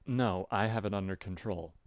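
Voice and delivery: ordinary delivery, nothing marked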